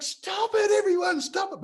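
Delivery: very high, squealed protest; exasperated mock shout. Speaker begging Swedes to stop accepting chips, exasperated mock shout. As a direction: plead at the top of your range, hammed-up. Acts out pleading with everyone to stop.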